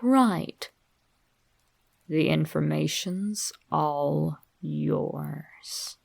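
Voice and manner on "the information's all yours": slow, soft, breathy-free whisper. A narrator's reading. Narrator confiding the final secret to the reader in a slow whisper. Ominous closing line to reader.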